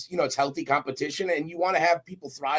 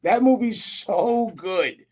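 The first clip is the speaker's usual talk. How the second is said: big, loud burst of praise that slows right down; excited gushing; 9 semitones higher, 6 dB louder, much slower than usual. Real, not acted. Guest gushing to host about Private Parts, excited. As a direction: rave about the movie you love.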